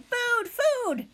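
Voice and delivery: high-pitched hungry peep; high, insistent hatchling cry; tiny gosling voice. A narrator's reading. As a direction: cry out like a baby bird demanding food. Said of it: "The tiny voice".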